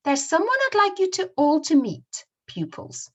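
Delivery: high, loud teacher's announcement; teacher addressing class. Acted. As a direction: announce it brightly to a roomful of pupils. Teacher addressing pupils in acted strip.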